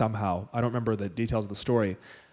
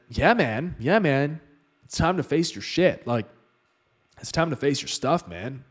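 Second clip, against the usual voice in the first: laid-back guru voice; 3 semitones higher, slower than usual. Retold or imitated. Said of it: "like, literally like that".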